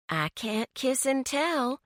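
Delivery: goading. A narrator's reading. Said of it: "Francesca goaded her mother".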